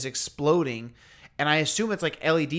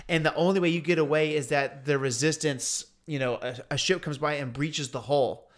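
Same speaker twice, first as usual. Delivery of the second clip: normal talking voice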